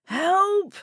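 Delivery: high, loud panicked yell; terrified cry. Acted. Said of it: "The man's cries continued".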